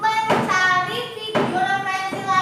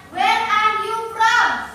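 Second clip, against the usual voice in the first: slow model question; 4 semitones higher, 4 dB louder, slower than usual. Shown as a demonstration. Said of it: "Terus tanya".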